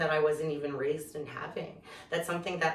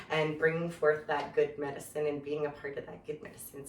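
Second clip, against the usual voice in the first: normal talking voice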